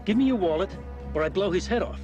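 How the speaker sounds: loud, forceful hold-up demand; angry threat. Acted. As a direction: threaten him loudly, losing patience. Mugger threatens to shoot after refusal.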